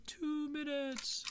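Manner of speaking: high, slow, far-off answer; distant voice from inside the arena; very faint reply. Acted. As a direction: call it from far away. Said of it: "a very faint".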